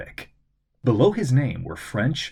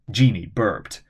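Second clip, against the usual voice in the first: plain reading voice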